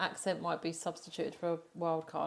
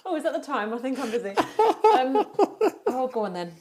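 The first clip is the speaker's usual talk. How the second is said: mock excuse; 4 semitones higher, 8 dB louder than usual. Mock excuse, then giving in.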